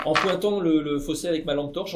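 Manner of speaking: normal talking voice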